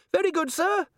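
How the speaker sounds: very high, strained squeal; panicked dwarf. A narrator's reading. Dwarf dangling from the candle line, high panicked voice. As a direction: squeak it out, barely holding on. Dwarf hanging, answering order.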